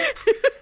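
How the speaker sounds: high trailing laugh; real laugh. Real, not acted. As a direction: let the laugh fade out. Laughing at own joke.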